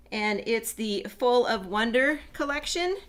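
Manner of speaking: normal talking voice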